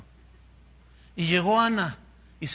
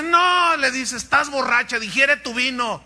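higher, louder scolding priest; scolding shout; 6 semitones higher, 5 dB louder than usual. Pretend. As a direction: bark at her to sleep off the wine. Elí rechaza a Ana, gritando. (regaño de sacerdote, más alto y fuerte)

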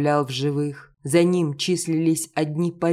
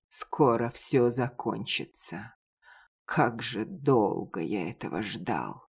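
low, weary man's voice; low tired hero voice; 4 semitones lower, 6 dB quieter than usual. A narrator's reading. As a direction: say it low, worn out after long waiting. Hero answers Elaine, tense. (низкий усталый голос героя)

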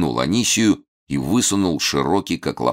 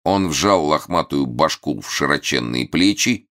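usual speaking voice

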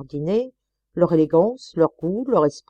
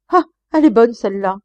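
higher, much louder scoffing retort; indignant outburst; 5 semitones higher, 9 dB louder, faster than usual. Acted. Husband retorting to his wife's blame, indignantly loud. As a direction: scoff in outrage at her charge. Marital quarrel after the dinner.

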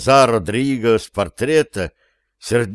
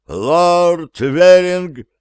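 loud, slow, drawn-out herald call; grand entrance announcement; 6 semitones higher, 5 dB louder, much slower than usual. Acted. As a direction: call the name out across the hall.

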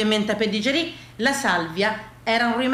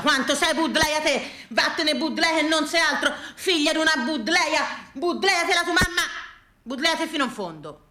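higher, hammed-up string of abuse; mock insults; 6 semitones higher than usual. Gardener uses a plant name as a swearword, mock-abusive. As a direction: hurl it like a swearword. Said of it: "credevo davvero che fosse una parolaccia".

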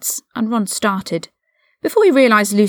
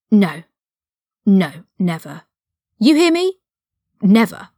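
fierce refusal. A narrator's reading. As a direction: refuse point-blank. Ron fiercely refuses the suggestion.

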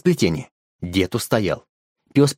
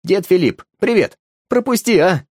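calling out; 7 semitones higher, 3 dB louder than usual. Acted. Driver calls out from car.

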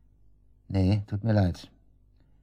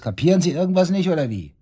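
angry snap; 8 semitones higher, 4 dB louder than usual. Acted. Suspect snapping at the detective.

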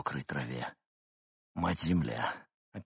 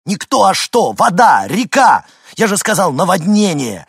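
loud, high, exasperated shouting; failing to stay calm; 11 semitones higher, 13 dB louder than usual. A narrator's reading. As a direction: shout it at someone who won't understand. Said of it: "пытался говорить как можно спокойнее, но получалось плохо". (раздражённый, отчаянный крик)

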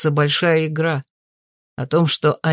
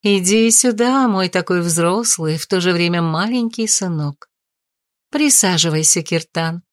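ringing crystal chime voice; 2 semitones higher than usual. Acted. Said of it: "Хрустальный голос матери".

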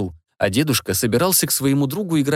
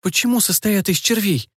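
anxious; 8 semitones higher than usual. A narrator's reading. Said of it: "тревожно расспрашивала я".

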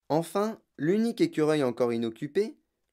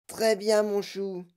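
higher motherly voice; placating mother; 7 semitones higher, slower than usual. A narrator's reading. Mother yielding to her daughter, placating. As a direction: give in, pitched up.

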